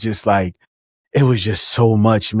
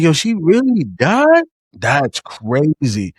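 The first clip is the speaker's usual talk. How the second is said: much higher, slow stunned disbelief; 5 semitones higher, much slower than usual. Retold or imitated. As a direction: stretch it out, stunned. Retold shock, second take.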